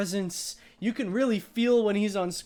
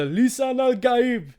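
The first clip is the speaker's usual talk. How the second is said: mock-epic announcement, raised and drawn out; grand proclamation; 7 semitones higher, 5 dB louder, slower than usual. Shown as a demonstration. Host hailing the prophesied title in a movie recap, grandly proclaimed. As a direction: proclaim the title like a battle cry. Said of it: "have to say it like that".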